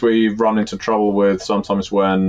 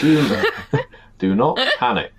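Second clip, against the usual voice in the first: laughing mock announcer; 5 semitones higher than usual. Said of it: "nuclear holocaust going on".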